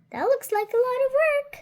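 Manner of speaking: high, fast, thrilled reaction; excited. Real, not acted. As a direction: rattle it off in sudden excitement. Child reacts to picture.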